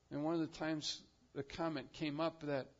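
ordinary delivery

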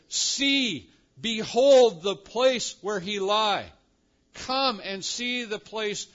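loud, high, slow proclamation; commanding. Acted. Angel commands the women to see the empty place, commanding. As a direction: order them to look, stretching each word. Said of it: "comes to them with a command, an imperative".

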